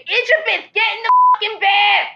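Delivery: shrill high yell at her daughter. Retold or imitated. Mom yelling at her grimy daughter to bed, high shout. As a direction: bellow it up high like a fed-up mom. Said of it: "even though I'll say".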